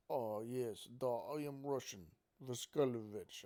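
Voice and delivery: eighties movie villain Russian; worst fake Russian accent. Weird kid pretending to be Russian, bad Russian accent. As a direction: ham up a terribly faked Russian accent. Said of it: "speaking the worst Russian accent you've ever fucking".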